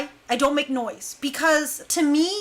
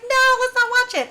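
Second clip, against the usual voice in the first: mock protest; 11 semitones higher than usual. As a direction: imitate a group protesting in a high voice. Acted group protest against watching.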